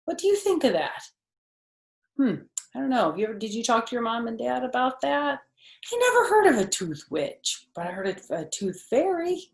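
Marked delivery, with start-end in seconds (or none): none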